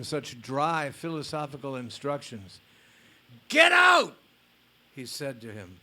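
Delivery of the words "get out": very high, very loud yell of rage; furious shout. Retold or imitated. Guru yelling at his fault-finding disciple, furious shout. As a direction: blast him out of the room. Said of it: "he yelled at his disciple".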